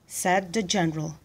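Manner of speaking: ordinary delivery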